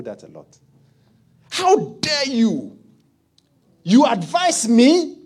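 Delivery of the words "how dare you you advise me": outraged rich man. Acted. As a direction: bellow it as an insulted snob. Offended rich man scolds poor adviser.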